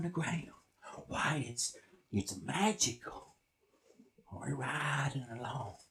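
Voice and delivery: soft, low campfire storytelling; hushed spooky narration. Acted. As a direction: draw them into the eerie night, quietly. Hushed spooky scene setting.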